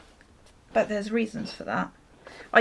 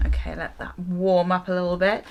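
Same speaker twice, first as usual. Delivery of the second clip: normal talking voice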